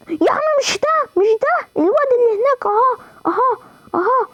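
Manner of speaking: high, urgent pointing out; frantic high gamer shouting; frantic high pitch. Real, not acted. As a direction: squeal at the screen to look there. Gamer frantic directing teammate.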